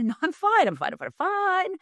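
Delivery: high, rushed, mock-reassuring chant; over-insistent cheerfulness. Outside self being palatable to everybody, over-insistent cheerfulness. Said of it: "be palatable to everybody else".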